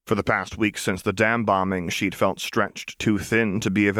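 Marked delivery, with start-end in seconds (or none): none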